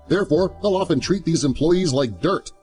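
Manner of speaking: plain reading voice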